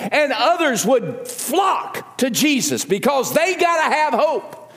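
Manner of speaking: high, emphatic pulpit exhortation; impassioned preaching. Real, not acted. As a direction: urge the congregation on with conviction.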